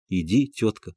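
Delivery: soft low words to the dog; quiet. A narrator's reading. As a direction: say it softly, nervous. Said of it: "сказал он тихо". (тихо, вполголоса)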